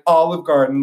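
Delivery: mock-eager pitch; joking suggestion. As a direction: toss it out as a joke. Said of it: "like, as a joke".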